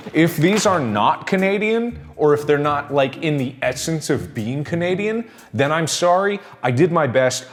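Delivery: normal talking voice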